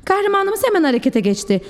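plain reading voice

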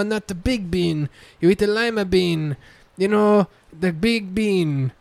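fussy mock Portuguese accent explaining beans; fake Portuguese accent. Explaining lima beans to guys in a fake Portuguese accent. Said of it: "racially ambiguous fucking accent".

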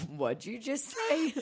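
incredulous, giggly question; retold laughing. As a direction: ask it slowly through rising laughter. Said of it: "I started laughing, and I go".

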